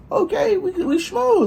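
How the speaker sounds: high-pitched delighted voice; high happy squeal. Retold or imitated. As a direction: squeal it, pleased. Happy about snacks.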